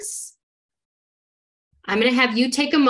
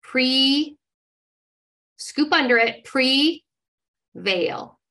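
read by parts; 2 semitones higher, slower than usual. Shown as a demonstration. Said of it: "Here we go".

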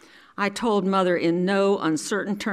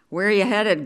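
yelling into the wind; 3 semitones higher, 5 dB louder than usual. A narrator's reading. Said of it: "he yelled into the wind".

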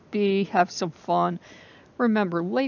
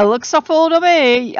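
higher and louder, an Italian nonna's voice; Italian grandmother; 8 semitones higher, 10 dB louder than usual. Shown as a demonstration. Admiring her full moon photo, as an Italian grandmother. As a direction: repeat it like a tired Italian grandmother, pitched up. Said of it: "I sound like my Italian grandmother".

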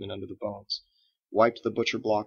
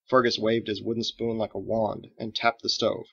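ordinary delivery, nothing marked

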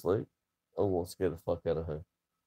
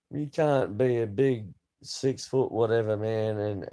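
usual speaking voice

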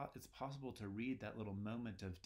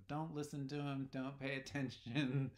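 higher, louder hushing wife's voice; Lady Macbeth hushing; 5 semitones higher, 5 dB louder than usual. Retold or imitated. Lady Macbeth tells the guests to ignore Macbeth, hushing them. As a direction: brush it off as the hostess would. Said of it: "Lady Macbeth is telling them".

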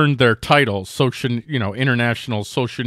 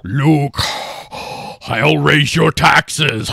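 slow, drawn-out villain voice with loud masked breathing; mock menace through heavy mechanical breaths; Darth Vader; 3 dB louder, much slower than usual. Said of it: "like Darth Vader music or".

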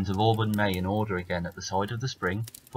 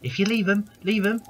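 high, squawking hooded crow; cawing bird, much higher; 12 semitones higher, 4 dB louder than usual. Acted. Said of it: "says a hoodie that was in the tree".

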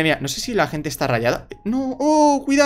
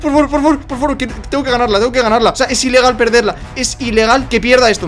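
1 high anxious pleading; 8 semitones higher than usual. Real, not acted. Begging not to be killed.

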